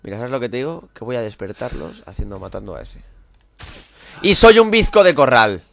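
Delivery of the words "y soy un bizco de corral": slow, loud jeering at himself; loud mock self-insult. Real, not acted. Gamer mocking his own aim, slow and loud. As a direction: bellow it like a clown.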